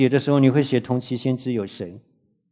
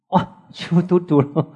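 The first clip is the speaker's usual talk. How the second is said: a bright, higher exclamation of surprise; delighted at all the raised hands; surprised wow; 6 semitones higher than usual. Real, not acted. Teacher to the class, seeing everyone read it: pleased surprise.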